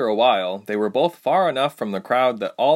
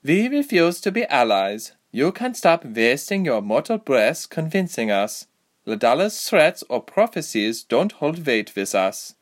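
stern royal refusal; 6 semitones higher, 3 dB louder than usual. A narrator's reading.

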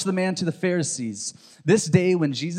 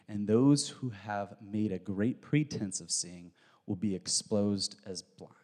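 solemn scripture reading; 5 semitones lower, 6 dB quieter than usual. Acted. Reads Jesus' solemn declaration.

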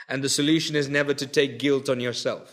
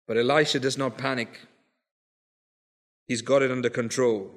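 soft, lower reassuring sermon voice; intimate preaching; 7 semitones lower, 7 dB quieter than usual. Acted. Preacher reassuring troubled listeners about their marriages, softly. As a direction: lean in and comfort them quietly.

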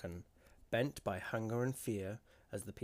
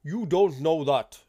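loud, high, drawn-out shout; 7 semitones higher, 19 dB louder, slower than usual. A narrator's reading. Grieving husband contradicting a woman in the crowd, shouted. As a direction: shout her down, slowly and forcefully. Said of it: "shouted another man".

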